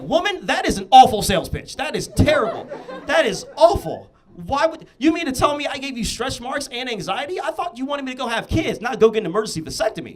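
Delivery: loud, high-pitched indignant retort; mock outrage. Son mocking his mother's pitch, loud and high. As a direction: blast the sales pitch in mock disgust. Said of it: "I was like".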